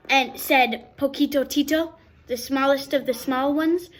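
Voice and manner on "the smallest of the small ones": ordinary delivery, nothing marked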